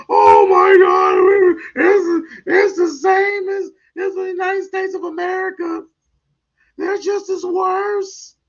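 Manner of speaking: whining passport bro. Retold or imitated. Mocking passport bros whining about women abroad. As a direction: imitate the disappointed travelers. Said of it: "they wanna come back home".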